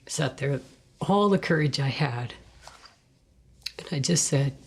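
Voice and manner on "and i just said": usual speaking voice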